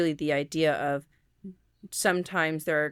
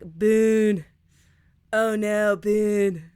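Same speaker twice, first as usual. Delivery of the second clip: louder, playful Southern holler, Boone stretched out; Southern drawn-out vowel; 3 semitones higher, 5 dB louder, much slower than usual. Acted. Calling the coonhound-looking puppy, Southern drawl. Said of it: "elongating the vowel or saying it in the Southern accent".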